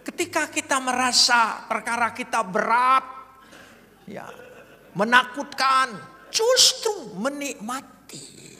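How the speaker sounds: loud, high and slow, hammering each word; forceful preaching. Acted. Preacher tells the congregation to enjoy their trials, forceful and slow. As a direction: preach it out to the whole hall. Preacher drives home the point.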